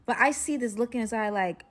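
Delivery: ordinary delivery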